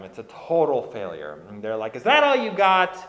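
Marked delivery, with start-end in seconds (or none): 2.0-3.0 loud, higher-pitched taunt; mocking jeer. Hyenas laughing at the cornered cub, mocking jeer. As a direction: throw it out as a scornful jeer. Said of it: "they just laugh at him".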